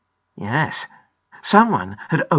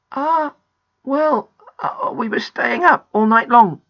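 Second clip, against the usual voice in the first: put-on high, hesitant boy's voice; embarrassed, stalling confession; sheepish; 6 semitones higher, 6 dB louder than usual. A narrator's reading. Peter owning up to Grandpa, sheepish. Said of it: "feeling very silly indeed now".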